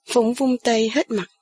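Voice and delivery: plain reading voice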